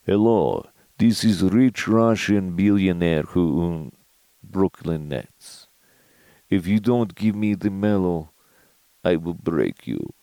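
put-on slow, menacing Russian accent; cartoon Russian oligarch accent on a prank call; Russian billionaire accent. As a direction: play the rich Russian tycoon in a thick Russian accent. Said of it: "the bit would go something like this".

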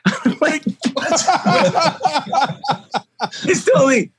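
real laughter through the words; can't stop laughing while talking. Real, not acted. Dad retelling his son's big word, laughing for real.

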